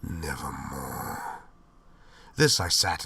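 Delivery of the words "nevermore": low, very slow, otherworldly croak; raven's answer. Acted. Raven answering the narrator, low, slow and eerie. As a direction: let the raven toll the word slowly and low. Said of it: "meant in croaking".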